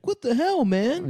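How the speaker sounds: high shocked Joey. Retold or imitated. Joey walks in on Chandler, high mock shock.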